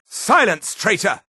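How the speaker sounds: high, sharp order to a captive; angry command. Acted. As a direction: cut the prisoner off angrily. Guard silences a captive.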